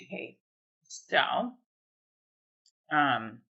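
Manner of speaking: usual speaking voice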